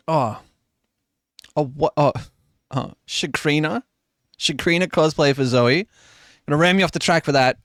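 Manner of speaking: usual speaking voice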